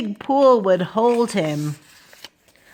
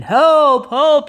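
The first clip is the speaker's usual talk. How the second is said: louder, raised cry for help; calling for help; 5 semitones higher, 6 dB louder than usual. Acted. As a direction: call out for backup. Policeman radios firemen for help.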